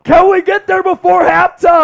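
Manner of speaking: high, loud hype shout; rally the chat, shouting; excited shout. Real, not acted. Hyping the sub count live.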